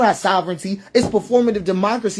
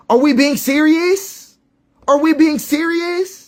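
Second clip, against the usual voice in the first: incredulous; 6 semitones higher, slower than usual. Real, not acted. Incredulous at repeated blame.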